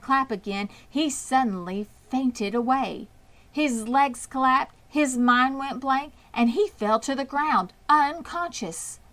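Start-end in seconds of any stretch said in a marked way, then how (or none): none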